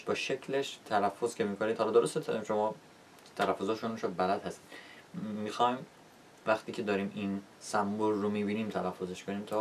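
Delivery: normal talking voice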